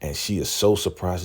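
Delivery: usual speaking voice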